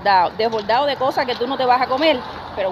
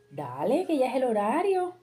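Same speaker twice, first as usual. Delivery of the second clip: imitated wake-up nudge; 4 semitones higher than usual. Friend wakes her for the meeting time, sing-song nudging. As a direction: mimic a friend coaxing someone out of sleep.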